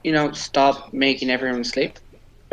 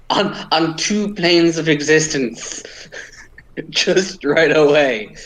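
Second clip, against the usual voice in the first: louder, slow, incredulous laughing objection; laughing protest; 3 semitones higher, 4 dB louder, slower than usual. Acted. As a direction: protest through laughter, drawing the words out. Laughing protest about double war.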